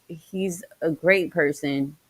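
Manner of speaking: ordinary delivery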